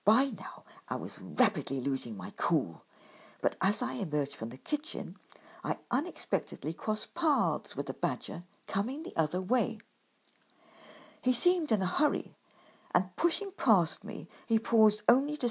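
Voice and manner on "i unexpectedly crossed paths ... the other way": plain reading voice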